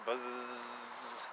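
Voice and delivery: ordinary delivery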